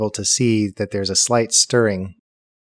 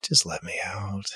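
whispered, muffled from inside; faint voice behind the lid; through a wall; 9 dB quieter than usual. Acted. Voice inside the closed coffin.

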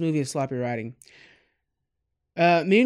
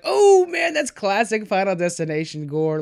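excited groan; 3 semitones higher, 4 dB louder than usual. Retold or imitated. Viewer reliving a gory movie scene, excited groan.

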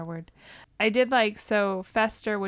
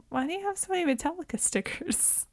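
baffled; 10 semitones higher, 4 dB quieter, faster than usual. Retold or imitated. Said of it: "And I'm like".

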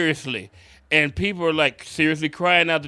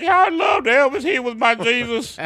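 mocking a tearful fan; 7 semitones higher than usual. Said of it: "they're like".

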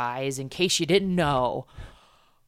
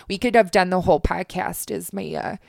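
lightly drawn Minnesotan lady; hushed Minnesotan woman voice; Minnesotan accent; 2 semitones higher than usual. Shown as a demonstration. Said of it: "my Minnesotan woman".

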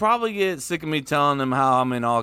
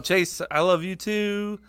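mock tender; 7 semitones higher, slower than usual. Mock love declaration to Chase.

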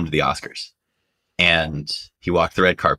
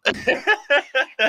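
high laugh. Real, not acted. Laughing at the punchline.

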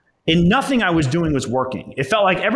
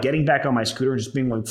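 usual speaking voice

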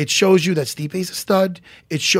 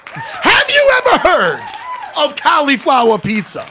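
very high, drawn-out loud yell; screaming; 12 semitones higher, 6 dB louder, slower than usual. Retold or imitated. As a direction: bellow the question slowly at the poor clerk. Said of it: "Please stop screaming at me".

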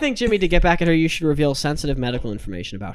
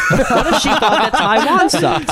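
mock girlfriend voice; 7 semitones higher, 4 dB louder than usual. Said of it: "She was like".

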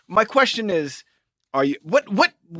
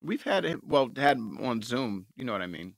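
low, dazed stoned mumble; 9 semitones lower, 10 dB quieter than usual. Real, not acted. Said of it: "you're so stoned right now".

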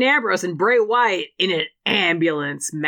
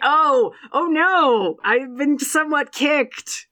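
slow, higher, unconvincing "oh no" of a man barely kicked; hammed-up fake hurt; 8 semitones higher, much slower than usual. Connor selling Finn's kick with mock, slow pain. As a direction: drag it out like a lazy wrestler selling badly. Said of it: "He had to do, like, a real".

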